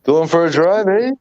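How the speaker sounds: put-on Canadian with a tagged-on "eh"; Canadian accent. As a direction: join in with a joking Canadian question. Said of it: "In a Canadian accent".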